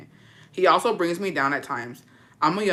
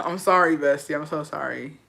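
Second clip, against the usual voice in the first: ordinary talk, nothing marked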